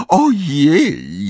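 high, loud wail of dismay; dismayed moan. A narrator's reading. Fleur seeing her reflection in the microwave, moaning. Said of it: "jęknęła Fleur".

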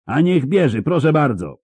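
loud, high-pitched comeback; yelled back. A narrator's reading. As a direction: yell it back at the crowd. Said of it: "odwrzasnęła".

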